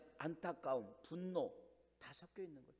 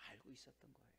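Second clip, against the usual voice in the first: breathy hush, almost no voice; whisper; 5 semitones lower, 26 dB quieter, faster than usual. Real, not acted. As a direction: let the words fall into a whisper. Sermon climax, hushed aside. (속삭이듯 숨소리로)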